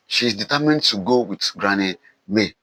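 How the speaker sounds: usual speaking voice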